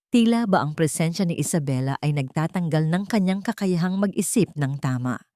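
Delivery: ordinary delivery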